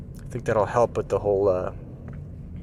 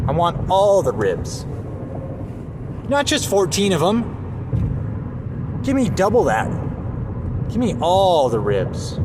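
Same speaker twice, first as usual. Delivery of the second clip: high demanding voice; 7 semitones higher, slower than usual. Pretend. Greedy demand for more ribs.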